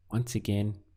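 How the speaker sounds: ordinary delivery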